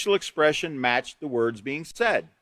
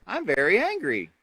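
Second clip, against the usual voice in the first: smiling while saying angry words; 4 semitones higher than usual. Shown as a demonstration. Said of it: "Like, smile and say".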